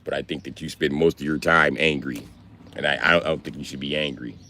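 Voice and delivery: low, calm, measured lecture voice; imitating big brother. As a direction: do your big brother giving gentle advice, low and even. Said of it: "let me imitate my big brother's how he talk".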